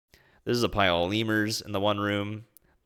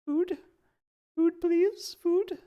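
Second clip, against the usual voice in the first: very high, squeaky otter voice; little animal pleading, pitched way up; begging otters; much slower than usual. Acted. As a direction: beg for food in tiny high yelps. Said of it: "They all look at you like".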